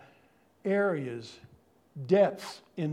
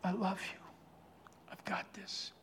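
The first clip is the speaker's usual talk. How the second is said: much softer, near-whispered tender words; quiet voice of God; 13 dB quieter than usual. Retold or imitated. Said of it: "in that quiet voice in my heart".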